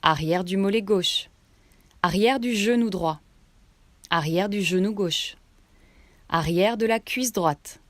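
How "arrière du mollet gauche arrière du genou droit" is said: ordinary talk, nothing marked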